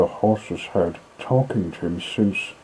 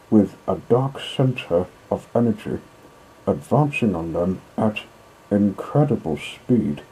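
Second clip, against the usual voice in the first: plain reading voice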